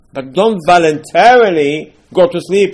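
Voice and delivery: slow, loud, stretched-out warning; preacher calling out slowly and loudly; emphatic loud warning. Real, not acted. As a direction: drive the warning home to the room.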